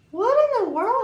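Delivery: high-pitched imitated disbelief; incredulous husband. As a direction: exclaim in mock shock, pitch way up. Husband reacts to the story.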